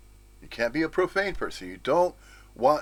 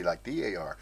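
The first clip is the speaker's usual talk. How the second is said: usual speaking voice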